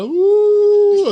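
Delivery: high, loud drawn-out howl "ऊ"; eerie fox howl. Retold or imitated. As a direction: howl it long like a fox in the dark. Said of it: "असं करून".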